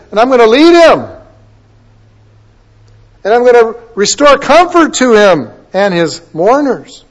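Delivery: loud, high, declaring voice; God's proclamation. Acted. Preacher quotes God promising to lead and comfort, loud high proclamation. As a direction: proclaim the promise with ringing force. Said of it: "And then he says".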